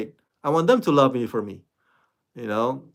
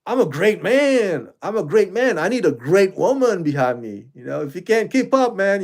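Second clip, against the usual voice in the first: puffing himself up as the great man; mock boastful Majors; 5 semitones higher than usual. Vlogger mocking Majors' taped boast to his girlfriend, mildly pompous. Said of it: "he said".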